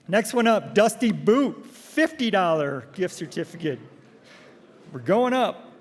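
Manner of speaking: loud, high prize announcer; raffle caller. Acted. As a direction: call the numbers slowly to the crowd. Calling raffle prizes and numbers.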